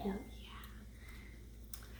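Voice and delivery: breathy little whisper. Real, not acted. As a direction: whisper it softly.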